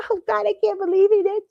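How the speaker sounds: high, gushing fake amazement; mock starstruck gush. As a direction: ham up the fake disbelief of a star on stage. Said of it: "get out on stage and say".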